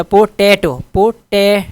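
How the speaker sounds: Potato drawn out with टै stressed; stress on second syllable. Shown as a demonstration. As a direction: push the टै in potato. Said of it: "दूसरे पर जोर है second पे".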